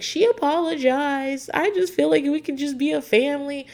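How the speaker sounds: imitating the mom. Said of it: "the mom's like".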